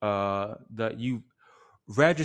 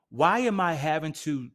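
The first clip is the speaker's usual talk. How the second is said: normal talking voice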